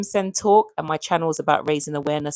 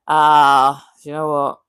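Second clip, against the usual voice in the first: ordinary talk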